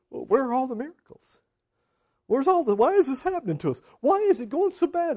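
high, mock-whiny griping; complaining. Gideon answers the angel's greeting with questions, whiny complaining. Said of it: "He's complaining".

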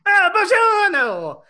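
slow, loud, high hammy buongiorno; fake Italian accent. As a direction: belt it out like a cartoon Italian. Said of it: "this fakey Italian accent".

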